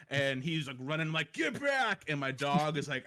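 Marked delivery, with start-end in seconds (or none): 1.3-2.0 sharp high yell of warning; panicked little yell; kid yelling. Retold or imitated. Son running at the groundhog.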